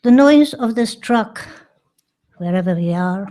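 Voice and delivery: measured poem delivery with long pauses between phrases; poetry reading. Acted. Poet reads in memoriam sequence for John Cage to audience, poetry reading. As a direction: read each phrase as a separate line, leaving space after it.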